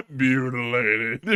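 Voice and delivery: slow word through laughter; laughing at the mangled fish. Real, not acted. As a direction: repeat it while cracking up.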